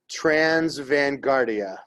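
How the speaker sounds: slow, self-conscious American try at Italian transvanguardia; bad Italian attempt. Shown as a demonstration. Teacher admits to the class his Italian accent is bad. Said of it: "a really bad American".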